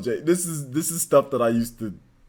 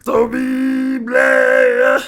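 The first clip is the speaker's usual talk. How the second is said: announcer voice; 11 semitones higher, 10 dB louder, much slower than usual. Pretend. Announcing a name in voice.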